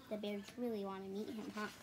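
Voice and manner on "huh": plain reading voice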